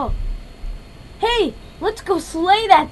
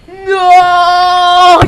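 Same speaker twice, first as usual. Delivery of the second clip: loud shout; 7 dB louder than usual. Pretend. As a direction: yell it out in the fight. Fighting the dragon.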